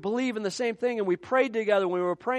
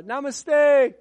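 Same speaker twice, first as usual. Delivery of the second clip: villager greeting; 8 semitones higher, 8 dB louder than usual. Retold or imitated.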